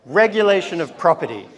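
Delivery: angry lawyer yelling; angry shout. Shown as a demonstration. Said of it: "you're an angry lawyer or something".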